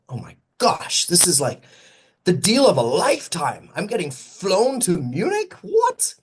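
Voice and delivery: can't believe your luck, building to a high What; excited disbelief. Retold or imitated. Host retelling his Munich invite, replaying his thrilled disbelief.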